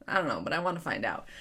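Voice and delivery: ordinary delivery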